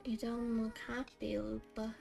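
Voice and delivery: normal talking voice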